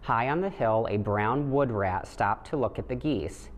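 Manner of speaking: plain reading voice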